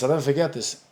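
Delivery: ordinary talk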